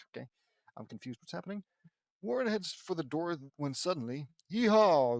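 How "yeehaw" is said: loud, high "yeehaw"; cowgirl holler. A narrator's reading. Sister with a lasso joins the chase, hollering. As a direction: whoop it like a rodeo rider.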